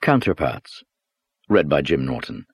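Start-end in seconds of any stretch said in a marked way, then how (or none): none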